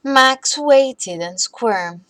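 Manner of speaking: usual speaking voice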